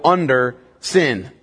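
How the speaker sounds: "under sin" said slowly, each word weighted; slow stress. Shown as a demonstration. Pastor spelling out the key phrase to his listeners, slow stress. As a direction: lay it down slow so they can underline it. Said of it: "underline the words in your Bible".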